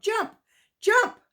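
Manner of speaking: high urgent cry of jump; sharp, high command; urgent call. A narrator's reading. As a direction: urge the tired dog to leap. Boy calls dog to jump.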